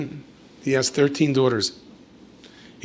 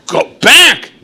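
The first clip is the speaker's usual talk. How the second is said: mock urgent shout; 13 semitones higher, 11 dB louder than usual. Urging him back.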